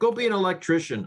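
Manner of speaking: high-pitched mock dismissal; elitist tone. Voicing the author's attitude toward students, elitist and dismissive. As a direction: wave them off like an elitist. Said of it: "that's kind of the tone you get".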